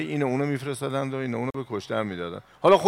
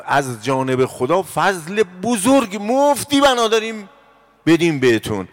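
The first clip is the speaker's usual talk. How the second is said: higher, louder impassioned sermon delivery; forceful preaching; 6 semitones higher, 7 dB louder than usual. Acted. Preacher urging believers from the pulpit, impassioned and loud. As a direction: drive the verse home to the congregation. Preacher raises voice explaining verse.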